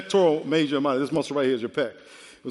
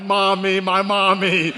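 comic, louder plea for mommy; mock crying for mommy; 2 semitones higher, 6 dB louder, slower than usual. Preacher acting his college self calling for mommy, mock cry. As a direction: wail for mommy as a joke. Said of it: "I was screaming because I was like".

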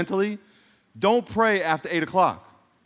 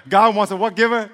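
usual speaking voice

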